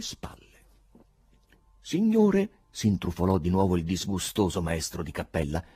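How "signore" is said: high, loud character voice calling out; officious choirmaster. A narrator's reading. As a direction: cut in, loud and pitched way up. Choirmaster butts in to scold Ivan.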